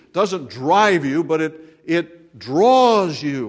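a slow, weighty draws; contrast drive and draws. Shown as a demonstration. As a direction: stretch it draws you right out. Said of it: "contrast drive and draws".

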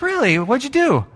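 put-on high, astonished kid voice; a child asking in disbelief, pitched high; young [name]. Young [name] asking his sister about her day, high and amazed. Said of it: "I'm like".

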